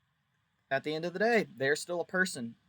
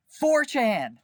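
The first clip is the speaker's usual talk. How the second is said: four chan blurted out high and loud; big loud mock-shocked announcement; dramatic reveal; 8 semitones higher, 10 dB louder than usual. Real, not acted. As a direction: hit it like a shocking punchline. Dramatic reveal of game's maker.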